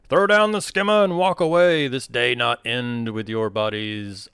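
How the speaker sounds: loud, high menacing villain threat; evil villain monologue. Acted. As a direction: boom it out like a gloating villain. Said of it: "evil villain monologue and just say".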